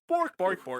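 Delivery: mock Scandinavian cook babble; bouncy put-on puppet gibberish; Swedish chef. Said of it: "the Swedish chef from Sesame Street".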